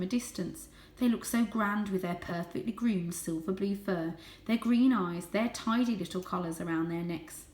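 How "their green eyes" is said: plain reading voice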